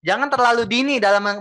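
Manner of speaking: ordinary talk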